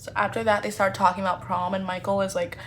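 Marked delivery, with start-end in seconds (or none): none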